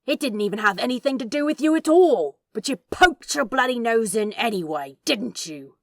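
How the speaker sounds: seething demon, teeth clenched; angry through clenched teeth. Acted. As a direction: spit it out, jaw locked tight. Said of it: "between clenched teeth".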